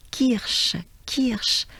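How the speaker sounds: Kirsch as kiʃ, no hard r; French Kirsch. Shown as a demonstration. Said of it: "So remember".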